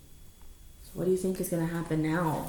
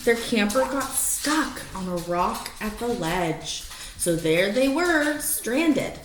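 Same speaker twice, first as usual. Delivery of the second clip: lively rhyming read-aloud; story narration; 4 semitones higher, slower than usual. Acted. Reading a rhyming picture book to the dogs, lively storytelling. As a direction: read it like a bedtime picture book, bouncing the rhymes.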